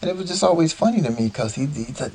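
ordinary delivery

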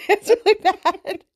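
high, laughing, slow. Real, not acted. Cohost laughs at her partner's awful British accent, giggling. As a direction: laugh through the words.

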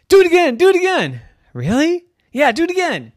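high, fast, excited chant; eager friends. Acted. Friends begging Dora to fall again, fast and excited. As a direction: beg like excited kids.

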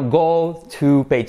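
slow, separate words; slow model. Shown as a demonstration. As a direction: say GO TO PAGE slowly, word by word. Slow model before linked version.

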